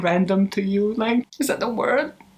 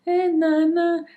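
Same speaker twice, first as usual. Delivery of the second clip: put-on high, childish singsong; 8 semitones higher, slower than usual. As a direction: babble it up high like a small child. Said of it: "This is like a child who said".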